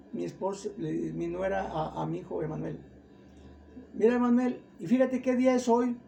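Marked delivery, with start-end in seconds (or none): 3.9-6.0 higher, louder retold call to look; raised, insistent quoted voice; urging attention. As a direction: imitate a relative pointing out something striking. Daughter-in-law alerts Emanuel to date.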